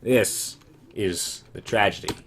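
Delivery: slow, grand, theatrical pronouncement; dramatic announcer. Acted. Player framing the next level, slow dramatic announcement. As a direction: intone it like a stage herald.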